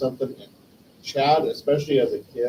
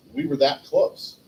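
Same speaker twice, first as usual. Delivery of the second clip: ordinary talk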